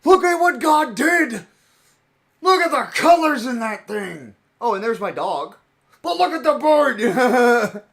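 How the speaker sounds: high, loud, thrilled yelling about a bird; bursting with awe, laughing by the end; excited shouting. Pretend. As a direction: gush at the photo like it's a miracle. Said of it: "computer and go".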